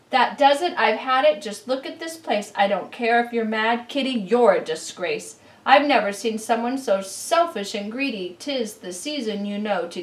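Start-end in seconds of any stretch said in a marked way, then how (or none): 0.1-8.3 loud, exasperated telling-off; loud exasperated owner; fed-up scolding. Acted. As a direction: lose patience with the cat. Owner losing patience.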